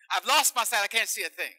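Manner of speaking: loud, high overplayed complaint; hammed-up lament. Fake blind patient to doctor, loud hammed-up lament. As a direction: ham up the blindness.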